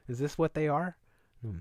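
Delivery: ordinary delivery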